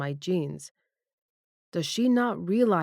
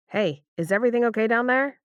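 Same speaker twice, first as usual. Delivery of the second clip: loud, fast, raised call; 6 semitones higher, 5 dB louder, faster than usual. Acted. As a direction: call down through the door. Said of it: "Ryder's muffled voice calls out".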